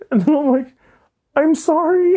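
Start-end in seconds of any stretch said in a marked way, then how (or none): 1.3-2.2 high, squeaky mock apology; retold mortified apology. Storyteller replaying an embarrassed apology, high and flustered.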